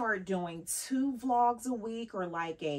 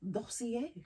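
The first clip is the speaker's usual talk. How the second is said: fancy French accent; 4 semitones higher, 7 dB quieter than usual. Shown as a demonstration. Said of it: "with a French accent, it becomes fancy".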